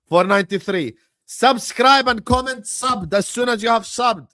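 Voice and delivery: loud, high hype to the viewers; rally the crowd at full volume; excited rallying. Real, not acted.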